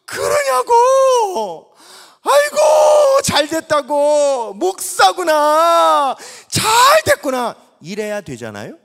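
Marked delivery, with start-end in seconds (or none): 0.0-7.6 very high, loud and slow cry of joy; joyous shout. Pretend. Pastor expected to shout with joy at the news. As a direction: shout out delighted congratulations. Imagined expected joyful reply.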